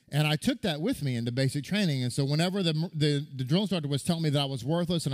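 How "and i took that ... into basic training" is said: ordinary delivery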